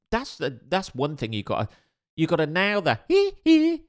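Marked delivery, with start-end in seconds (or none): 3.1-3.8 high squealed hee hee; a quick falsetto whoop. Retold or imitated. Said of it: "if you're doing a [name]".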